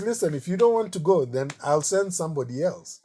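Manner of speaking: a touch of an impatient patron; mock stern Ludovico. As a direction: lay down the terms, teasing. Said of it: "And he goes".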